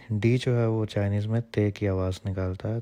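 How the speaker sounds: ordinary delivery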